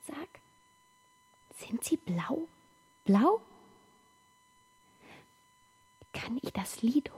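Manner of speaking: hushed, wondering whisper. Acted. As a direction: whisper it over the still body. Whispered questions at bedside.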